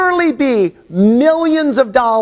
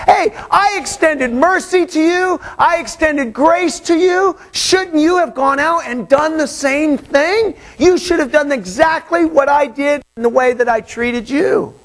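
pointed reproach; 6 semitones higher than usual. Acted. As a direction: drive the point home as the lord. Preacher paraphrasing the lord.

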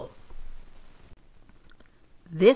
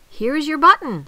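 high, loud, eager announcement; excited call; 10 semitones higher, 9 dB louder than usual. A narrator's reading. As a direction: call out the find brightly and loudly. Frog announces another find.

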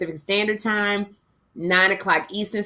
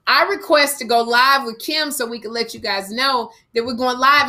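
ordinary talk, nothing marked

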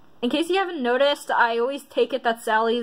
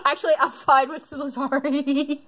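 words breaking up into squeals of laughter; laughing; 4 semitones higher than usual. Real, not acted. Quiz taker gets Lazari as her result, laughing. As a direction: talk through high-pitched giggles.

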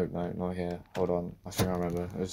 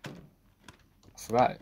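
ordinary delivery

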